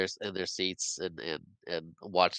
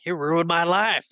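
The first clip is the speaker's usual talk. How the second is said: mock-furious cry, pitched up and louder; retold angry outburst; 9 semitones higher, 5 dB louder than usual. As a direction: hurl it as a hammed-up accusation. Pete charges and tackles Everett.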